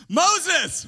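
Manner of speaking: loud, high "Moses"; calling Moses. Acted. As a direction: call out to "Moses". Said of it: "wrong big biblical character".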